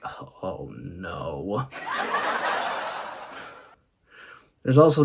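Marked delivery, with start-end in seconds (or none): 0.0-1.7 deep, soft "Oh, no" groan; catchphrase groan, low and soft; very low dismayed groan. Retold or imitated. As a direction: drop very low and quiet in dismay. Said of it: "I think".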